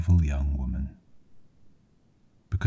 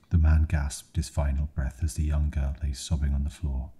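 ordinary delivery

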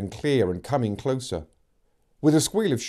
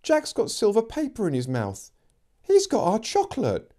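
put-on indignant girl's voice; 10 semitones higher than usual. A narrator's reading. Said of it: "Megan was indignant".

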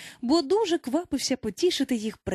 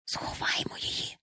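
urgent stage whisper; 7 dB quieter than usual. A narrator's reading. Someone urges the boys to hide Wendy, whispering. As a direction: whisper it, no voice at all. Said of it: "прошепотів хтось".

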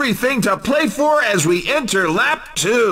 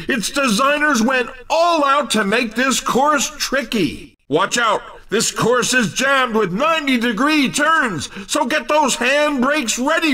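ordinary talk, nothing marked

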